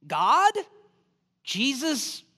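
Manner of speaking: ordinary delivery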